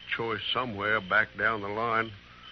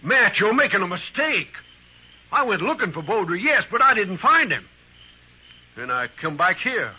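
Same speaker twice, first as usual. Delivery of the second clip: higher and louder, frantic protest; desperate denial; 7 semitones higher, 5 dB louder than usual. Acted. Bert begs the marshal to believe him, desperately. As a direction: plead innocence as the voice climbs. Accused friend protests his innocence.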